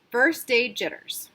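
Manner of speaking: plain reading voice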